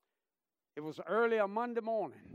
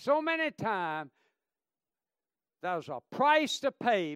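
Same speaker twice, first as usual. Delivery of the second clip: the same words, louder than plain but well below the outburst; preaching voice; 3 semitones higher, 7 dB louder than usual. Acted. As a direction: come down from the shout, still preaching. Repeat after outburst.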